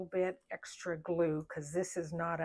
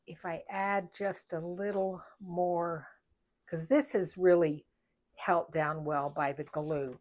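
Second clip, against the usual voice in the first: ordinary talk, nothing marked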